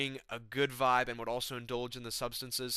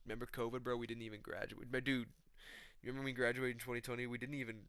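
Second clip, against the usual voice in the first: soft, muttering young-dude impression; Joshua Van; 9 dB quieter than usual. Young guy telling older friends about covid, softly mumbled impression. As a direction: quietly reminisce like a soft-spoken young bro. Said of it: "Joshua Van's there. He's like".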